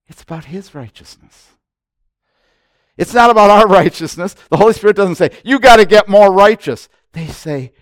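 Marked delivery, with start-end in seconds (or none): 3.0-6.9 loud, high pulpit declaration; full-force preaching. Acted. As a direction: proclaim it to the crowd.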